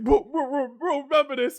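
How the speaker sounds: high, loud stammered warning; mock parting threat. Fox talked down before others, spluttering loudly. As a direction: splutter it loudly as a beaten rival.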